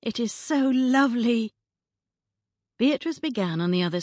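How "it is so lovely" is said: put-on excited young woman. Friend delighted by bonnet.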